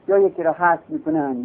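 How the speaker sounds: usual speaking voice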